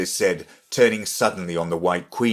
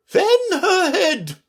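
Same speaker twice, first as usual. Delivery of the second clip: loud, very high, slow order; urgent high-pitched command; anxious interruption; 6 dB louder, slower than usual. A narrator's reading. Red Queen interrupting to cool Alice, anxious. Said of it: "the Red Queen anxiously interrupted".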